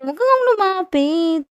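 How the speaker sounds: high flustered don't come closer; flustered warning. Acted. Girlfriend flustered as her boyfriend leans in, high pitched. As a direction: fend him off, all flustered. Flustered girl fends him off.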